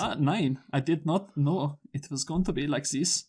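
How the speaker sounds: slow, hammed-up German accent; mock German accent. Host answers his cohost in a slow mock German accent. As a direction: slowly drawl it in a put-on German accent. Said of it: "can't do a German accent".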